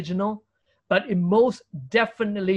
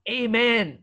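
raised, confident Amen; bold amen; 4 semitones higher than usual. Shown as a demonstration. Believer coming before God, bold and confident. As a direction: say Amen boldly, pitched up. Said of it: "able to end so boldly".

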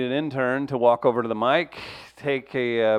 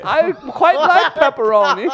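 very high, louder put-on voice; high-voiced visitor; 11 semitones higher, 7 dB louder than usual. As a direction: interrupt as a squeaky, polite stranger.

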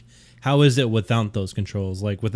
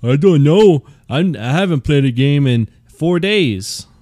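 mopey self-imitation; 4 semitones higher, 6 dB louder than usual. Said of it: "'cause I'm usually in here like".

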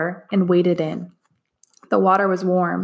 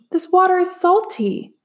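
higher, surprised cry; exclaimed; 11 semitones higher than usual. A narrator's reading. Min Lee tasting the stream, surprised cry. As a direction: blurt it out in disbelief. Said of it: "Min Lee exclaimed".

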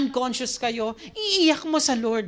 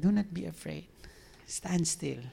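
soft, low reassurance; reassuring; 7 semitones lower, 9 dB quieter than usual. Acted. The Lord answering Moses' complaint, soft and reassuring. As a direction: say it quietly and steadily to calm. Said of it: "Sabi ng Lord".

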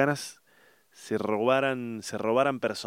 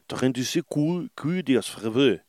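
higher, rough stab at a French accent; attempted French accent; 4 semitones higher than usual. Shown as a demonstration. Retrying the film title, in an improvised French accent. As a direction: say the title in makeshift French, swallowing the endings.

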